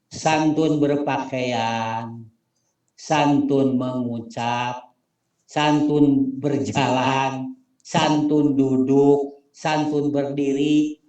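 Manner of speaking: each santun drawn out slowly; slow teaching list. Shown as a demonstration. Teacher drilling the santun points slowly for the class. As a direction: spell out every santun slowly and deliberately. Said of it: "Pertama dulu santun".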